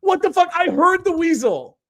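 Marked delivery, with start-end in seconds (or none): 0.0-0.5 much higher, loud disbelief; real shock, voice jumps up; high astonished outburst; stunned surprise. Real, not acted. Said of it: "surprise at own missed voice".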